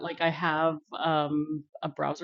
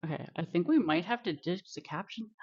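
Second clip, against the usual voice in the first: normal talking voice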